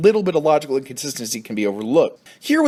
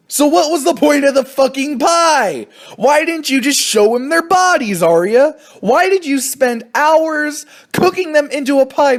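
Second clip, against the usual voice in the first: much higher and louder, an incredulous tirade; exasperated rant; 13 semitones higher, 4 dB louder than usual. Real, not acted. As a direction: yell at Arya like she's right there. Ranting at Arya about the pie.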